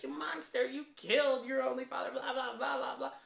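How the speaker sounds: high mocking squeal with a laugh; mock her freaking out, laughing through it; mocking Rey. Mocking Rey's panic at Kylo, high and squealy. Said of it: "She's like".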